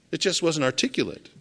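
ordinary talk, nothing marked

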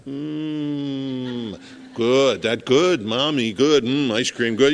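put-on slow, babyish mmm and good; toddler savoring ice cream. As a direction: coo it like a small child tasting something sweet. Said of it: "sees mom put a spoon of ice cream".

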